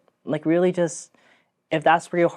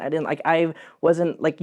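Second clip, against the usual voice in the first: normal talking voice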